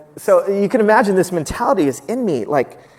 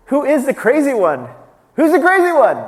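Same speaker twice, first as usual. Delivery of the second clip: much higher and louder, a ringing challenge; preaching shout; 10 semitones higher, 7 dB louder than usual. Real, not acted. Preacher challenging the congregation, shouting the question. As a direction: hurl the question at the room.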